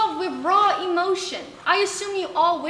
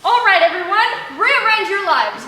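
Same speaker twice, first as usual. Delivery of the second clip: commanding; 3 semitones higher, 7 dB louder than usual. Acted. Addressing crowd.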